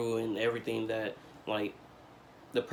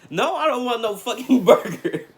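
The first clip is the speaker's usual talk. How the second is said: higher, louder laughing outburst; exasperated shout; 11 semitones higher, 10 dB louder than usual. Retold or imitated. Refusing his girlfriend's burger, loud and laughing.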